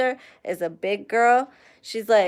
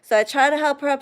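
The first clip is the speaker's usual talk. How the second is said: ordinary talk, nothing marked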